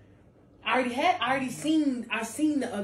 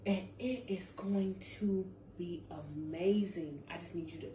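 hushed reassurance; whisper; 4 semitones lower, 7 dB quieter than usual. Real, not acted. Intimate close of message.

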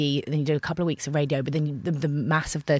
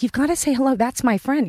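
mother coaxing; 7 semitones higher, 4 dB louder than usual. Retold or imitated. Mum urging her shy daughter to greet a colleague. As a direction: coax a shy child to say hi. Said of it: "she's like".

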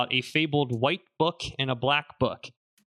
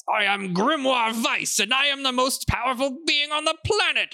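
pompous British voice; 11 semitones higher, 3 dB louder than usual. Acted. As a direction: proclaim it like a pompous British aristocrat. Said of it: "pompous British voice".

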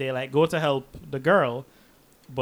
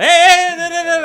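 high yelled protest; mock shout of a stranded friend; 14 semitones higher, 9 dB louder, much faster than usual. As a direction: yell after the departing car. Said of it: "He's, like, shouting".

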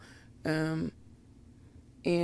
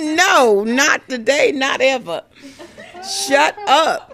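loud, high refusal breaking into laughter; bossy no, laughing through it; flesh refusing, laughing; 8 semitones higher, 12 dB louder than usual. Acted. Her flesh telling her soul off, a loud, high refusal with laughter. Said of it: "My flesh said".

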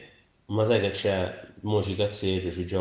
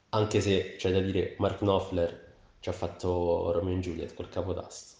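ordinary talk, nothing marked

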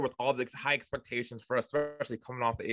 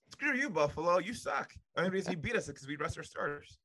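sarcastic taunt; 7 semitones higher than usual. Acted. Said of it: "That was sarcasm".

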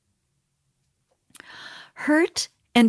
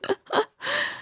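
laugh; 6 dB quieter than usual. Real, not acted. Mother laughing at her son's story.

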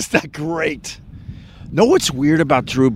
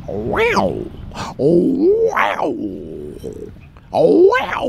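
amazed; 3 semitones higher, much slower than usual. Real, not acted. Reacting to Aniston.

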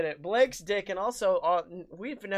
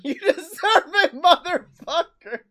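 laughing; 11 semitones higher, 10 dB louder than usual. Pretend. Obscene taunt, laughing.

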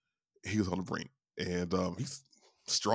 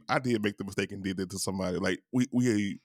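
ordinary talk

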